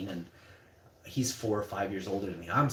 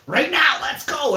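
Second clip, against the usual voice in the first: much higher, much louder dare; defiant challenge; 11 dB louder, slower than usual. Retold or imitated. Teen with stones dares the bullies, loud defiant shout. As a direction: shout the dare, ready to fight. Daring four guys with stones.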